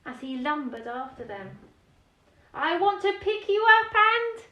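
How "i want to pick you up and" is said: loud, high big-dragon voice. Acted. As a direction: boom it out as the eager dragon. The dragon speaks.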